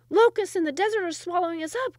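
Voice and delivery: high, loud desperate cry. A narrator's reading. Sultan cries out to his court in despair. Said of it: "cried the Sultan".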